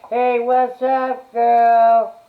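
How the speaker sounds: high, loud, slow squealed greeting; imitating bestie. Imitating her best friend answering the phone, squealing. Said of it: "the phone rings and it's her".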